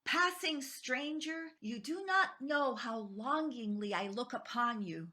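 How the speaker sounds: ordinary talk, nothing marked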